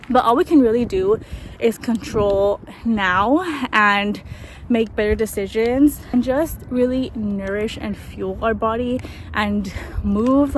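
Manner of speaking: usual speaking voice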